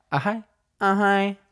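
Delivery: high cutesy hi, repeated; mock YouTuber greeting. Host repeating the YouTuber girl's cutesy hello. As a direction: coo the greeting like a girl on camera. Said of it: "Who's that girl who says".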